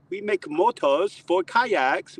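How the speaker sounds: put-on high, slow German-accented pitch; German sales pitch. Torqeedo owner pitches kayak motors, German accent. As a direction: sell it slowly in German English.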